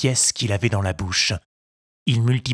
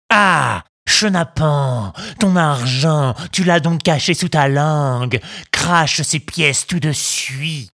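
loud, slow angry robber; angry bandit; 4 semitones higher, 4 dB louder than usual. Acted. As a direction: scold the sly little thief. Bandit discovers hidden coins.

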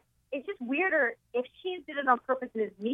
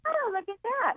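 much higher, impressed; women gawking; 11 semitones higher than usual. Retold or imitated. Imitating women staring on the beach, high and impressed. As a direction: gasp it out like a curious woman. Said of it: "the women are like".

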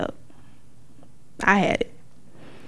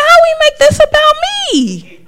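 much higher, louder, laughing protest; hammed-up squeak of protest; high squeal of disbelief; 8 dB louder than usual. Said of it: "I said".

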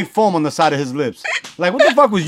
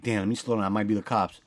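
softer, lower muttering; muttered to himself; 6 semitones lower, 10 dB quieter than usual. Retold or imitated. Driving alone, muttering to himself as a car appears, quiet and low. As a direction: mutter it under your breath. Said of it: "I'm like".

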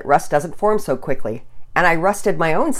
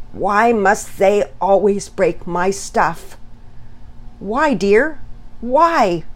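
higher, pleading exasperation; slightly more composed; 6 semitones higher, much slower than usual. A narrator's reading. Dad appealing to mom about his stuff, a bit more composed. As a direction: plead slowly, frustration held partly in check. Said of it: "with a bit more composure".

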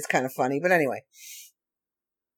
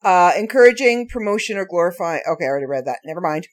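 normal talking voice

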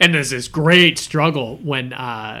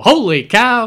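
higher, louder catchphrase impression; Phil Rizzuto; 9 semitones higher, 6 dB louder, much slower than usual. Retelling the movie's Phil Rizzuto bit, an imitated excited call. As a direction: exclaim it like an excited ballgame announcer. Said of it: "does that Phil Rizzuto".

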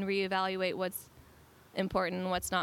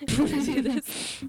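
higher, louder, laughing repeat of the invite; 5 semitones higher, 5 dB louder than usual. Acted. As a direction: laugh through the playful question. Joking invite, laughing along.

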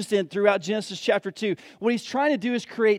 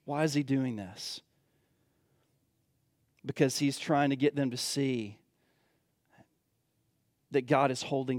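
much softer, lower, drawn down; hushed intensity; 7 semitones lower, 8 dB quieter than usual. Acted. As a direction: pull them in with a near-hush. Preacher drops to hush.

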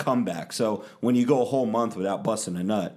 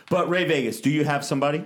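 ordinary talk

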